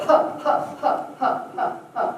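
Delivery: string of breathy, punched "huh" sounds; rapid-fire airy huffs; diaphragm huhs. Shown as a demonstration. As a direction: pump short bursts from the belly. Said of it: "try more of a".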